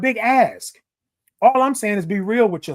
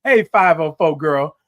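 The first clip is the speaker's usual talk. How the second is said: ordinary delivery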